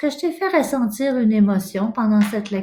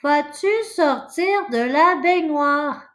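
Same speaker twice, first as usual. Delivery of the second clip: higher, acted mother calling out; mother's voice; 8 semitones higher than usual. Mother asking child to leave the bathtub, high acted calling. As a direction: play a mother calling her child out of the bath. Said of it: "j'entends la voix de ma mère".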